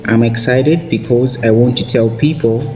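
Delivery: ordinary delivery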